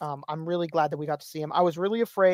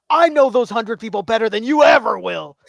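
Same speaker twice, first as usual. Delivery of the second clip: loud, cocky game-show taunt; playful trash talk at full volume; mock smack talk; 3 semitones higher, 9 dB louder than usual. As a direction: boast loudly like a cocky contestant. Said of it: "Family Feud smack talk".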